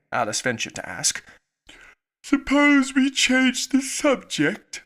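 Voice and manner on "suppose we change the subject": high, slow yawn through the words. A narrator's reading. March Hare to the table, bored, yawning. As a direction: drag it out mid-yawn.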